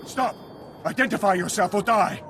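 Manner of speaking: high, sharp sentry shout; shouted challenge. Acted. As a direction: bark the challenge at an intruder.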